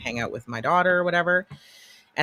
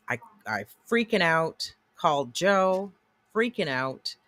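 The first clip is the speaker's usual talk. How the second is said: ordinary talk, nothing marked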